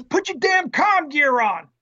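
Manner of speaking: high, drawn-out mock yell; angry sergeant's order. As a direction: yell it slowly as an exasperated sergeant. Acted sergeant yelling after a kick.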